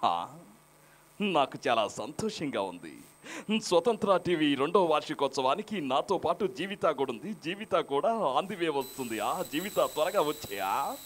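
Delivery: slow, soft, polished dubbing-artist voice; slow, weighty dubbed-hero voice; Sai Kumar. Acted. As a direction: imitate a film dubbing artist, measured and drawn out. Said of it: "అదే సాయి కుమార్ గారు అయితే".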